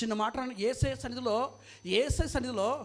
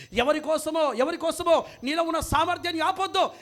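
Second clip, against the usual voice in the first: full-force preaching; 2 semitones lower, 7 dB louder than usual. Acted. Preacher urges believers never to stop their work, forceful exhortation. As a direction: push out long, sustained preacher's phrases.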